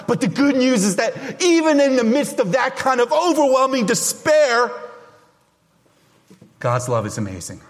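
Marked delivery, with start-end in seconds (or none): 0.1-4.7 rising fervour. Real, not acted. Preaching climax.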